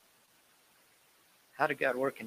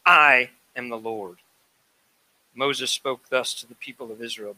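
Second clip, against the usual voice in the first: louder, formal reading of God's words; scripture reading; 10 dB louder than usual. Acted. Preacher reading Exodus to the church, solemn proclamation. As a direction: proclaim the text to the congregation.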